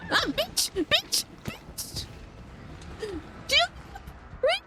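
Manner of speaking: high pitched shrieks of panic, rising; panicked shriek. Real, not acted. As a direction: yelp it out in real fright. Character caught in game.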